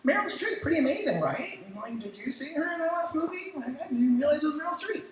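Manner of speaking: lightly voiced friendly creative; casual email voice. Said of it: "They'll say".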